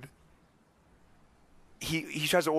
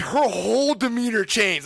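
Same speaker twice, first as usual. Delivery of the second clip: ordinary talk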